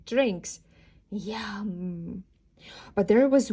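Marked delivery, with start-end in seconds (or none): none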